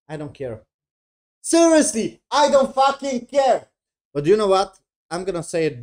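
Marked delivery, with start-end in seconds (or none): 1.4-3.7 very high, loud outburst; exasperated shout. Real, not acted. Reviewer fed up with the show's reunion, exasperated shout. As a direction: yell it in sheer exasperation.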